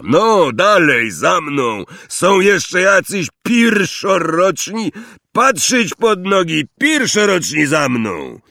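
high, loud herding calls; loud calling. A narrator's reading. Guide leading first-years down a dark path, calling loudly. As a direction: holler to gather the stragglers.

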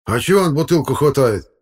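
high, loud grumbled protest; calming outcry. Acted. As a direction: cry out with the anger starting to subside. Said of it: "уже утихая, выкрикнул дядя Лёня". (выкрик, уже утихая)

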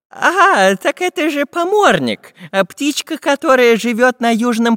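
high, loud recognition; excited geographer. A narrator's reading. Geographer recognises the bird overhead, high and excited.

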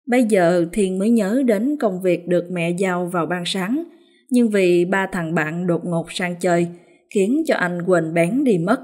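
usual speaking voice

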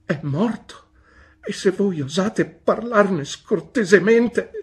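the widow rebukes the aunt; indignant outburst. A narrator's reading. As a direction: rise up in wounded anger. Mother defends dead husband.

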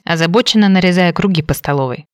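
ordinary delivery, nothing marked

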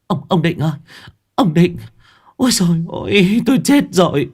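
high, loud wail for her husband; panicked cry. A narrator's reading. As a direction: call him in terror. Wife cries out to husband. (vợ gọi giật giọng chồng, kêu cao và to)

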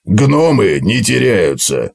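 slow, gruff dwarf declaration. Acted. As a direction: state it slowly like a stubborn dwarf. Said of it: "заявил Готрек".

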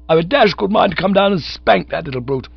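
put-on high, loud outraged young gent; indignant threat. A narrator's reading. Cyril threatening the manager's child, high indignation.

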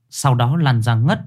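plain reading voice

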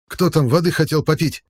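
panting, high shouted jab; breathless shout. A narrator's reading. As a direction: yell it while out of breath. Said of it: "задыхаясь, выкрикнул Боков".

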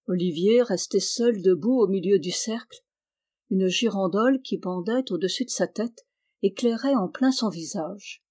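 plain reading voice

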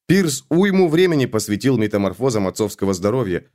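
ordinary delivery

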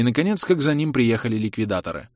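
ordinary delivery

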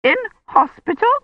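very high, scandalised question; sputtering outrage. A narrator's reading. Mrs Gadsden objects to a hospital visit, sputtering high-pitched outrage. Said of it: "she sputtered".

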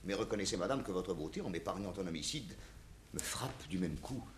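court her in a hushed, lingering voice; gallant compliments. Acted. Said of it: "débiter des galanteries".

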